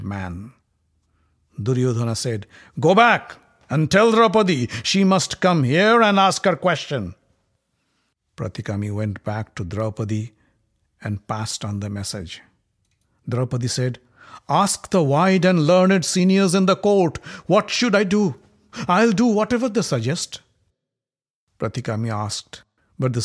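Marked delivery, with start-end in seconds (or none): 2.8-7.1 a higher, louder imperious prince giving orders; commanding prince. Acted. Prince Duryodhana orders the messenger back, imperious. 14.5-20.4 a higher, raised voice of a proud queen; defiant queen. Acted. Draupadi sends her challenge to the court, proud and raised.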